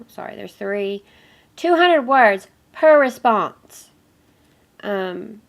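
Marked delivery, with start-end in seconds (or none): 1.5-3.9 slow, loud "Two hundred words per response"; emphatic. Real, not acted. Stresses assignment requirement.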